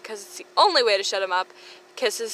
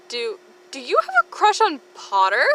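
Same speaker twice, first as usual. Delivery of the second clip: Pansy voice; 5 semitones higher, 5 dB louder than usual. Acted. Pansy asking in put-on voice.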